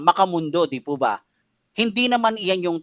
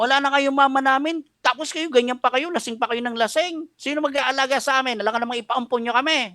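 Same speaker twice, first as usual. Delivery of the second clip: put-on a child's high scolding, pitched up; child scolding father; 8 semitones higher than usual. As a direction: scold him the way his little son did, with a teary edge. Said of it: "Oo, pinagalitan ako". (mataas na boses ng batang nagagalit)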